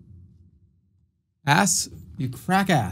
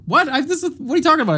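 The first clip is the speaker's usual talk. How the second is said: indignant disbelief; 11 semitones higher, 3 dB louder, faster than usual. Real, not acted. Chat claims rare streams.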